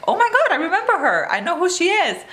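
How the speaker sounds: light up as it comes back to you; excited recognition. Retold or imitated. Vlogger recalling remembering the character, excited. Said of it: "I'm like".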